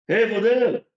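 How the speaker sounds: loud, high-pitched hail; calling out. Acted. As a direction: call out to a man passing by. Passer-by calls out to Fudel.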